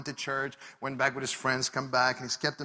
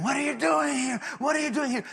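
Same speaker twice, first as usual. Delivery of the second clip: high-pitched yell at an intruder; imitated angry yell; 11 semitones higher than usual. Furious man storming down at a stranger in his parking lot, imitated yelling. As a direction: demand answers in a raised, high voice. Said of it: "yelling at him".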